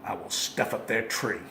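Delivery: low, sly villain voice; sly grin. Acted. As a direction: gloat it with a grin. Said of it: "grinned the Grinch".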